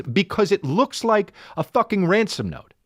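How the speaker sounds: high, indignant imitation of a partner; mock exasperation, pitch climbing; exasperated retort. Partner objects to the note on the door, exasperated.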